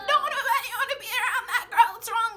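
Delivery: high, loud laughing jab. Real, not acted. Ranting to viewers about the friend, laughing loud and high. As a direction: cackle it out in disbelief.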